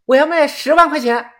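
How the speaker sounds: high-pitched call through the door; shouting through door. A narrator's reading. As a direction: pitch it up in a mild defiant shout. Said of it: "叶子隔着门喊道". (隔着门尖声喊)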